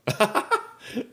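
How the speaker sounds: a high, bright burst of real laughter. Real, not acted. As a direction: let a loud laugh break out. Laughs at friend's story.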